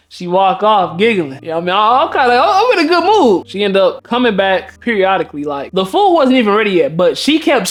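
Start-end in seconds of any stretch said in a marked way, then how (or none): 1.7-3.5 high, bubbly and half laughing; giddy delight. Retold or imitated. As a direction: let the pitch climb with tickled excitement. Giddy after she flirts with him.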